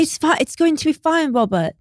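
mock British accent. Acting out a Prestige costume scene, in a mock British accent. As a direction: lightly play a British film star soothing Robert. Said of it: "Scarlett Johansson with her British accent".

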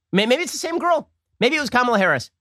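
high-pitched sarcastic amazement; mock wonder. Host pretending the little girl was Harris, mock wonder. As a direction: float it up as if naively marvelling.

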